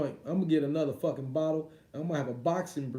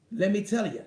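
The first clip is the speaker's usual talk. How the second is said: Fury's father impression; 3 semitones higher than usual. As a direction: play an English boxing dad bragging in an English accent. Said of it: "get that fucking accent down".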